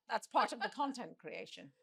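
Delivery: slurping a drink while talking; sipping noisily between words. Real, not acted. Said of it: "Stop slurping and start answering".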